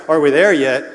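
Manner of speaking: lightly voiced road-trip whine; whining kid. Kid on a road trip asking parents, mild whine. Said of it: "They whined like a kid on a road trip".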